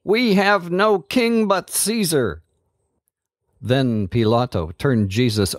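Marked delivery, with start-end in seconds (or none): 0.0-2.4 high-pitched defiant shout; shouted back. A narrator's reading. Leading priests answering Pilate, shouting high. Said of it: "The leading priests shouted back".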